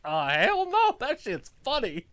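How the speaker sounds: high, loud imitated protest; mock outraged denial. Audience defending a puppet act, high loud mock protest. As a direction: whoop it as an amused crowd would.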